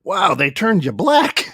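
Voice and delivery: high, slow, laughing disbelief; mock amazement. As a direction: imitate a friend's exaggerated wonder. Retells friend's joking astonishment.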